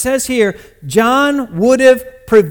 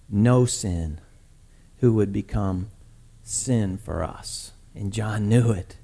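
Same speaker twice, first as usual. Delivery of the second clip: low, soft, solemn sermon delivery; hushed preaching; 11 semitones lower, 10 dB quieter than usual. Acted. Preacher explaining sin to the congregation, hushed and solemn. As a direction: let each phrase settle with long pauses.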